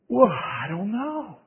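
slow, near tears; tearful. Retold or imitated. Young man unsure how to answer his mentor, near tears. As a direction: mumble it through a choked throat. Dreading the coming rebuke.

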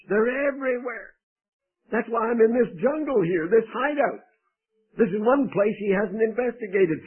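a frightened fugitive explaining his danger; anxious David. Acted. David voiced, anxious complaint.

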